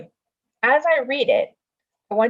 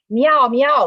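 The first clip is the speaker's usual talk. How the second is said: cat yell; 6 semitones higher, 7 dB louder than usual. Acted. Reading the cat's yell.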